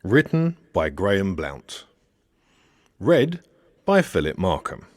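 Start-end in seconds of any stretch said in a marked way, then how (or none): none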